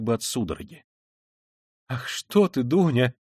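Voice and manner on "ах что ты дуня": flustered, pleading higher then sinking; embarrassed mother hastily appeasing. A narrator's reading. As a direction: plead anxiously to smooth things over. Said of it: "заговорила в смущении Пульхерия Александровна".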